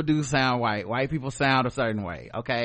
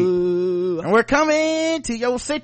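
high, stretched-out country hollering; slow, high mock country performer; 7 semitones higher, slower than usual. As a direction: draw it out high like a country singer.

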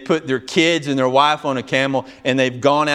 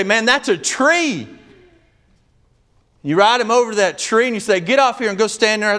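high, fervent preaching storyteller voice; preaching at full force; 9 semitones higher than usual. Acted. Preaching a desert tree story.

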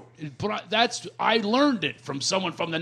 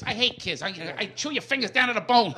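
high-pitched movie crook threat; mock-menacing wiseguy voice; Joe Pesci; 4 semitones higher than usual. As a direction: act out a cartoonish villain threatening kids. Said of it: "he busted into the scene. He goes".

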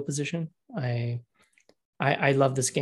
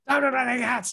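high cat lady voice; 9 semitones higher than usual. Retold or imitated. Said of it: "crazy cat lady".

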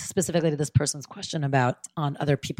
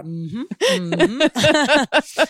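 higher, louder, laughing through the mock reflection; laughing self-mockery; 6 semitones higher, 6 dB louder, much slower than usual. Hosts joking about reviewing past fights, laughing mock regret. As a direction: giggle while playing your own regretful self. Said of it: "I'm going through my entire history being like".

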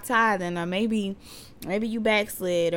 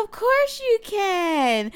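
playful sing-song; 13 semitones higher, 5 dB louder, much slower than usual. Real, not acted. Mother echoing her daughter's reply in a high sing-song.

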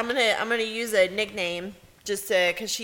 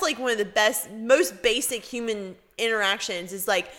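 usual speaking voice

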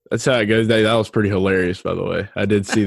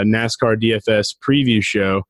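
usual speaking voice

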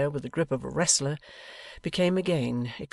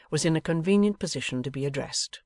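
plain reading voice

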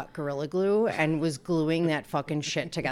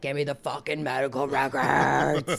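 low demonic growl; devil voice; 3 semitones lower than usual. Retold or imitated. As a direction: snarl it like a possessed devil. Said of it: "that was my devil voice".

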